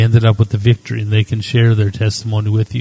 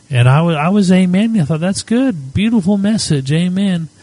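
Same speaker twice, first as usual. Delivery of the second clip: delighted amen; 7 semitones higher than usual. Retold or imitated. Retells his own delighted reaction.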